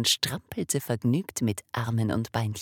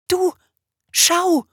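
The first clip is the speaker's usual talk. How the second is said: sudden, much louder, high shout; loud cry; 10 dB louder than usual. Acted. Father suddenly calling his wife to look, shouting. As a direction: burst out with amazement. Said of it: "rief der Wassermannvater auf einmal ganz laut".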